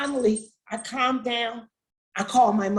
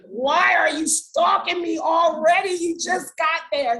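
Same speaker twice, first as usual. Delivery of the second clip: higher, louder retold scolding; exasperated mock shout; 6 semitones higher, 5 dB louder than usual. Mother scolding her son on the phone, exasperated. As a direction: act out an exasperated mom's outburst.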